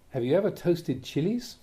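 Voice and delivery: usual speaking voice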